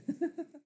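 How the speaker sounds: short high burst of laughter; a quick amused laugh. Real, not acted. Narrator laughs at the word.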